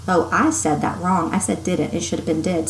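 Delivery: usual speaking voice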